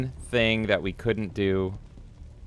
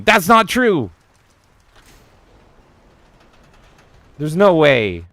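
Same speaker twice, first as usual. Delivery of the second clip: loud protest; 8 semitones higher, 10 dB louder than usual. Real, not acted. Denies chat's teasing loudly.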